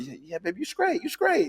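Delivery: mock girlfriend reassuring. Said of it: "Be like".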